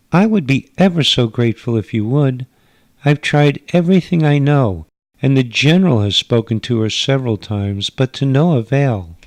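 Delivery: a gracious hostess from another world; alien mother. Acted.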